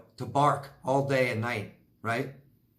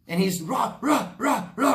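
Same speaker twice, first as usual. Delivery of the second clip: dog barking imitation; 8 semitones higher, 3 dB louder than usual.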